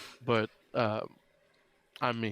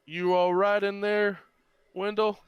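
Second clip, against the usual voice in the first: raised, higher, slow call; 9 semitones higher, 6 dB louder, slower than usual. Acted. Deputy checks on the sheriff in the cell, calling out. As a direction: call through to someone in the next room.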